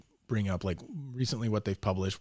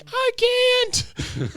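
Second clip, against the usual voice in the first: put-on squeaky little boy; high tiny child whine; small boy begging; high kid voice; 6 dB louder than usual. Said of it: "the kid's just like".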